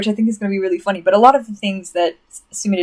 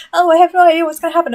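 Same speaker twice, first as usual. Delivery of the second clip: high, loud, fast mimicry; mock cluelessness; 9 semitones higher, 6 dB louder, faster than usual. Fan imitating everybody who missed the trope, mock clueless. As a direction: parody the clueless crowd, high and hurried. Mocking clueless viewers in a rant.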